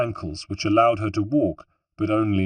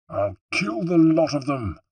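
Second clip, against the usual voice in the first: higher, grumbling threat; lightly voiced gruff Bartolomeo; 7 semitones higher than usual. A narrator's reading. Bartolomeo grumbling to Ezio about the guards, mock threat. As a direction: mutter the threat to your friend. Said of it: "muttered Bartolomeo".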